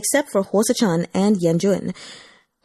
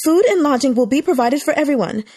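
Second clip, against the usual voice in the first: higher, raised voice to the crowd; announcing to crowd; 6 semitones higher than usual. A narrator's reading. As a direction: make sure everyone hears. Staff member addresses crowd.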